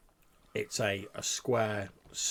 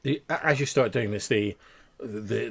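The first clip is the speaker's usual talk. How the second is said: ordinary talk, nothing marked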